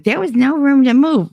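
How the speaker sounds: high-pitched imitation of a complaining ex; hammed-up protesting whine; mocked excuse. Acting ex's whining excuse.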